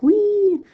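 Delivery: high loud gleeful wee; teacher imitating Grandma's noise; high squeal. Shown as a demonstration. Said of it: "noises like".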